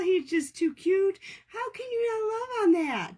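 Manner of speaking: high tender gush. Real, not acted. Gushing over cat.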